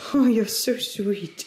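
slow, doting coo; cooing pet talk. Real, not acted. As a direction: melt over a sweet little dog. Cooing to the dog.

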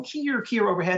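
high piercing keer like a hawk; hawk call imitation. Author imitates hawks for kids, high hawk cry. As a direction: mimic a red-tailed hawk cry.

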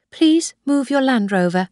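cool, distant demand to move the car; cold. A narrator's reading. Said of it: "I said coldly".

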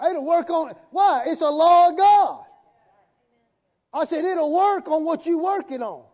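loud, high, driving sermon delivery; full-force preaching. Real, not acted. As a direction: declare it boldly over the congregation.

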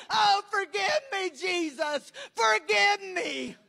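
high wail for forgiveness; screamed begging. Retold or imitated. Preacher voicing souls begging Jesus to forgive them, screamed begging. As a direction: cry out in desperation as the damned. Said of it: "Now they're screaming, curdling scream".

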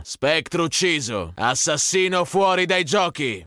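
usual speaking voice

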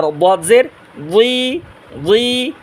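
"বদ জের বুই" slowly, heavy emphatic d from tongue-side; full, thick "dwi" for "বুই"; thick DAD. Shown as a demonstration. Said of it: "বদ মোটা আওয়াজে".

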